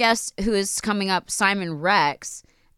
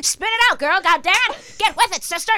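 sassy scolding; 12 semitones higher than usual. Pretend. Goading herself.

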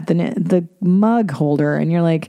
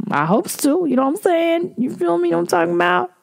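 much higher hyped-up questions; higher goofy bro voice; 12 semitones higher than usual. Pretend. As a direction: pitch it up like a hype man. Playful bro sign-off.

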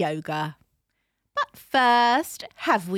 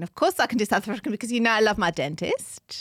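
put-on South African accent; lively imitation South African accent. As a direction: chat away as a South African. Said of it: "Can you do South African?".

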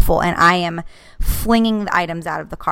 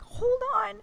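much higher, tight plea; strained high; 4 dB quieter than usual. Retold or imitated. Mom to screaming kids, high strained plea. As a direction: beg for a moment of quiet. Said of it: "and I'm like".

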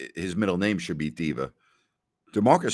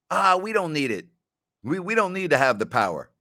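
higher, breezy dismissive voice; imitating carefree Jason; 8 semitones higher than usual. Jason brushing off his friend, lightly hammed carefree voice. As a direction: wave it off like it's nothing.

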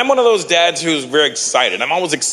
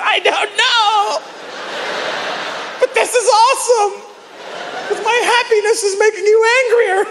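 laughing taunt. Retold or imitated. Man laughing back at his yelling woman, high giggly. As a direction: gleefully laugh at her anger.

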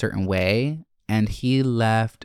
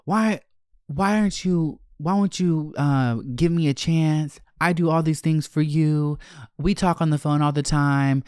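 higher-pitched imitation of a pleading man; mock pleading; 5 semitones higher than usual. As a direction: mimic him begging, pitched up. Voicing his plea for a chance.

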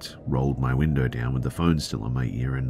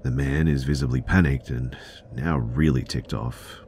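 ordinary talk

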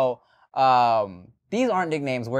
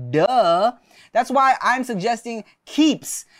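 upbeat, punchy sponsor read; 5 semitones higher than usual. Acted. Host reading a hair loss ad to listeners, upbeat. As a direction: sell it like a hype host.

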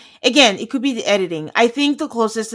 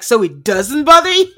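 louder mock-incredulous question, amused; pointed challenge; 3 semitones higher, 8 dB louder than usual. Co-host re-enacting the wife's challenge to her husband, loud and pointed. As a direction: imitate her forceful tone, on the edge of laughing. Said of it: "Like, the tone and the face".

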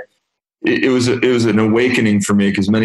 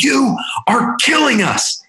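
much higher, slow, blunt voice for his colleague; slower than usual. Retold or imitated. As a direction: play the colleague telling him off, high-pitched and slow. Colleague confronting him, quoted.

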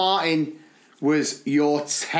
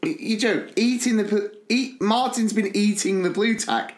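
retold disbelief; 7 semitones higher than usual. Acting out his disbelief at news.

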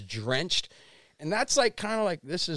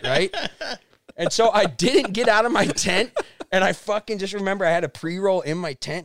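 ordinary talk, nothing marked

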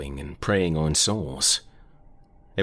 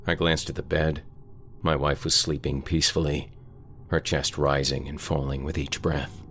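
usual speaking voice

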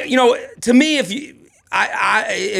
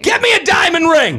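excited shout; 10 semitones higher, 3 dB louder than usual. Real, not acted. Giddy joke about getting engaged.

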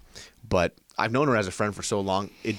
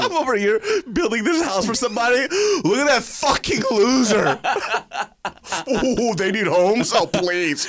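high, loud sneering voice; scornful party bro; mocking snob voice; 14 semitones higher, 5 dB louder than usual. Voicing mocking tourists.

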